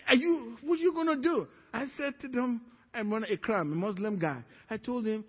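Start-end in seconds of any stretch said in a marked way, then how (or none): 0.1-1.5 excited challenge. Retold or imitated. Challenging worried coworkers.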